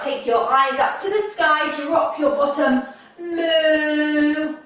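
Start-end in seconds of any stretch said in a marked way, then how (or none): 3.2-4.6 long, sustained imitated moo; cow moo. Shown as a demonstration. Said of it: "come back to our cow".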